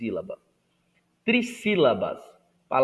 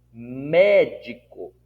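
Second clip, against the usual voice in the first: Médico with MÉ long and punched; Médico, swelling on its first syllable; stress on first syllable; 6 dB louder, much slower than usual. Shown as a demonstration. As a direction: lean hard on the MÉ of Médico. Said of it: "é na antepenúltima sílaba".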